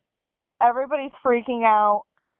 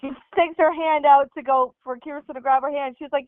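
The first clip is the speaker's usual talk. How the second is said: ordinary talk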